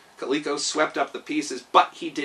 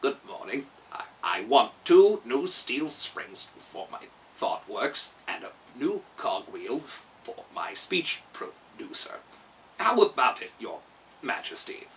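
Tik-Tok politely asking the king for springs, low and halting; clockwork man; 3 semitones lower than usual. Acted. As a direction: stammer like a wind-up machine running down. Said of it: "faced the astonished Gnome King".

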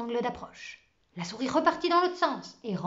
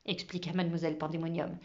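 ordinary delivery, nothing marked

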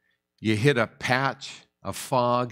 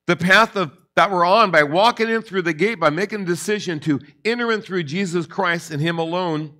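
rising preaching; 5 semitones higher, 5 dB louder than usual. Real, not acted.

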